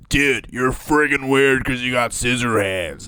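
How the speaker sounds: mock bully. Co-host playing the bully jeering at Edward Scissorhands, mock bully. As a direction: taunt slowly like a movie bully. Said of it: "there's the bully guy".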